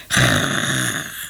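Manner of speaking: deep rattling choke in the throat; choking rasp. A narrator's reading. Stray cat choking on a fishbone, deep rattling choke. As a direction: hack a fishbone up with a low gargle. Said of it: "Er kwam een rochelend geluid uit haar keel".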